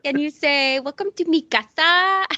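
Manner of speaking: high, hammed-up fake Spanish accent; mock Spanish accent welcome.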